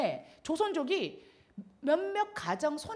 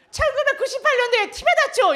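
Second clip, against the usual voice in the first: much higher and louder question; a caller on the phone, higher and louder; caller's question; 12 semitones higher, 11 dB louder than usual. Retold or imitated. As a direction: press eagerly for the memory.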